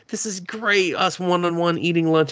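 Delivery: high, elated imitation of a happy guy; super happy. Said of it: "Jun's all super happy".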